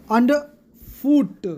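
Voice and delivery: underfoot, very slow, final syllable stressed; "underfoot" broken slowly for a class; anapest stress. Shown as a demonstration. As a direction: draw out "underfoot" and land on FOOT. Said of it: "unstressed और stressed syllable आएगा".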